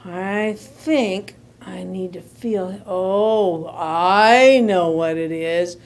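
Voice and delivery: low, slow musing doctor; pondering vet. Acted. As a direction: think it through slowly and low. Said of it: "and he said".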